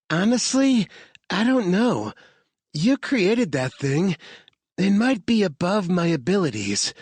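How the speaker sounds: hesitant hacker, lightly voiced; lightly voiced Sammy. A narrator's reading. Sammy telling Harvard she may not manage it, unsure.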